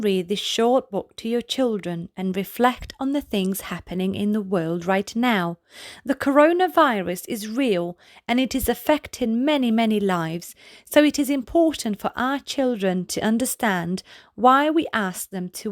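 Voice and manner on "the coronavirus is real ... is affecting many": plain reading voice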